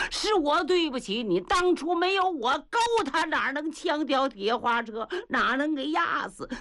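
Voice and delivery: high-pitched, performed lament; crying out loud. A narrator's reading. Grieving man blames himself for the death, crying out. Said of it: "放声大哭".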